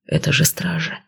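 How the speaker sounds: soft calming whisper. Acted. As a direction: whisper it to soothe her. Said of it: "шепнул муж".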